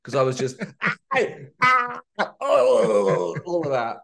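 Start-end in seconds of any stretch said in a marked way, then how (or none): 2.4-3.4 high strained dying cry; death scream. Shown as a demonstration. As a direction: wail like being struck down. Recording video game death sounds.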